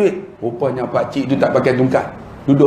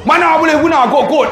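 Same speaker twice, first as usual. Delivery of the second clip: mock rude clerk; 11 semitones higher than usual. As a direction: sneer at the old man's excuse. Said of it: "dia kata". (kerani marah tolak kad pakcik, nada sinis)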